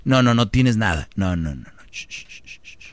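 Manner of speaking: mock lullaby hush fading to whisper. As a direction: soothe a child down to silence. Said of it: "como si fuera un niño".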